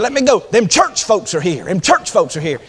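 high, loud imitation of an excited woman; excited hurry. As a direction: blurt it eagerly into the phone. Woman on phone as visitors arrive.

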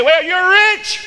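high, loud, drawn-up cry; hammed-up high exclamation; mock exclamation. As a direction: proclaim it in a loud, high pitch. Mocking what a man can say.

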